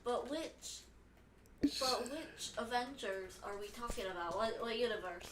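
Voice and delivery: soft, fussy nerd voice. Acted. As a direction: play a random nitpicking nerd. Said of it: "I'm doing just a random nerd".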